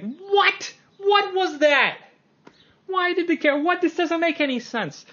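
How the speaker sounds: high-pitched incredulous screech at the screen; mock yelling. Movie watcher complaining at the screen, mock yelling. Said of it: "ends up yelling at the screen".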